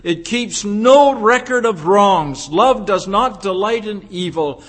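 loud, measured preaching cadence, each clause separate; forceful recital of scripture; emphatic scripture recitation. Acted. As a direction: drive each phrase home like a preacher.

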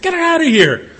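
high, loud order to throw her out; exasperated shout. Acted. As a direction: bark it like a fed-up judge. Judge yells order.

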